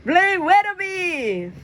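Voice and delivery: very high, slow, drawn-out call. Acted. Press calling out into the wind, high and drawn out. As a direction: chant it at the sky. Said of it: "Press calls out".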